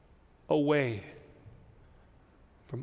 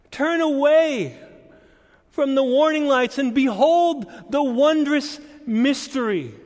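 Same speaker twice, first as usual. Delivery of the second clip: raised, higher voice driving each phrase home; impassioned preaching; 7 semitones higher, 5 dB louder than usual. Real, not acted. Preacher tells congregation what Advent says, impassioned preaching. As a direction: preach with mounting force and insistence.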